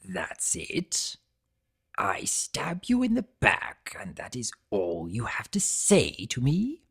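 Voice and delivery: whispered bitter question; hushed disbelief. Acted. Betrayer whispering in disbelief at the calm visitor. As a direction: hiss it out in quiet disbelief.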